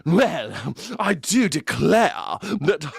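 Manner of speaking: slow, high mock Southern American accent; a Brit drawling like a Southern belle; Brit doing Southern drawl. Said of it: "I hear some Brit going".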